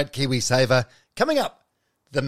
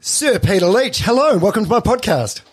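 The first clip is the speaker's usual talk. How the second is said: louder, higher greeting for the guest; host greets his guest warmly on air; bright welcome; 6 semitones higher, 4 dB louder than usual. Real, not acted. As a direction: welcome him in with a lift.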